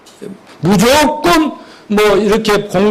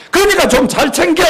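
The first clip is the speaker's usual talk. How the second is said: raised-pitch mock scolding; nagging mother; 7 semitones higher than usual. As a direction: nag the child to shape up. Acted mother nagging her child.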